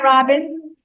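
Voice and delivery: normal talking voice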